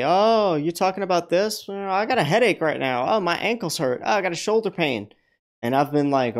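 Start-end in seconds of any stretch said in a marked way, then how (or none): 0.0-5.1 mocked livestream commenters. Livestream viewers challenging the healer, mock complaining. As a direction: imitate sceptical viewers piling on one ailment after another. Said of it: "people in live streams say".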